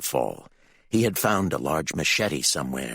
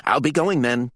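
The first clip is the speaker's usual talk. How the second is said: brisk, higher, louder character line; 7 semitones higher, 5 dB louder than usual. A narrator's reading. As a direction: say it briskly, ready to leave. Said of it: "Geneva said briskly".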